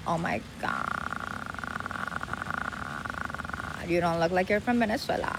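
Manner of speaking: LA vocal fry trailing endlessly; LA accent. Retold or imitated. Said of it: "¿puedes terminar la palabra?". (acento de Los Ángeles arrastrado)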